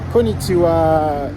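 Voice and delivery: ordinary talk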